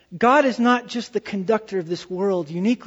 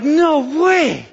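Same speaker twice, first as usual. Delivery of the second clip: loud, higher-pitched disbelief; amazed outburst; 6 semitones higher, 6 dB louder than usual. Retold or imitated. Preacher retells his reaction to the horns and bows, amazed outburst. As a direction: blurt it out in astonishment.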